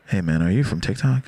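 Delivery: low-pitched approach; imitated male stranger. Stranger who grabbed his shoulder asking, imitated low voice. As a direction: drop the pitch for the stranger's question.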